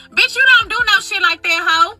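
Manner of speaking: ordinary delivery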